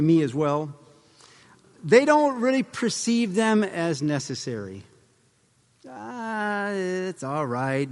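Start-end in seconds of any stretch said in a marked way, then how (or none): 5.9-7.9 soft, drawn-out brush-off; dismissive shrug. Acted. Voicing a driver who disregards stop lines, softly dismissive. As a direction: wave it away quietly.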